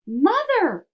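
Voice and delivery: loud, high, joyful call; cried out. A narrator's reading. Charlie running to his mother, loud excited call. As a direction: call out to her across the room, high and loud. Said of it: "cried Charlie".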